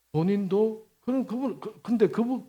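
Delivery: usual speaking voice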